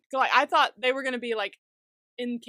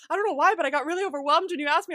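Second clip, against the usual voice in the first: higher, rushed imitation of a tearful mom; mock tearful voice; 6 semitones higher, 4 dB louder, faster than usual. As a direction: hurry it out, imitating someone overwhelmed. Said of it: "like, crying".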